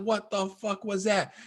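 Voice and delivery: a slow, hushed, frightened question; retold fear. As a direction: ask it as if the roar still hangs in the air. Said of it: "You're like".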